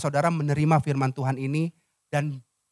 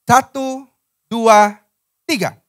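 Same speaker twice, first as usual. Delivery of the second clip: loud, high, drawn-out count; calling out a count-in; 5 semitones higher, 8 dB louder, slower than usual. Real, not acted. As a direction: lead a crowd into reading together. Leads congregation into reading aloud. (hitungan aba-aba lantang)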